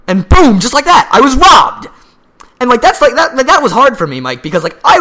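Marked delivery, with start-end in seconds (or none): 0.1-3.7 loud, high excited shout. Real, not acted. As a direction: yell the punchline. Climax of robbery story.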